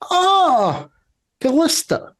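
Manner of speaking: very high gushing greeting; high-pitched hammed-up Southern lady; old Southern woman. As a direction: coo the name like a Southern matron. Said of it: "that old Southern woman voice".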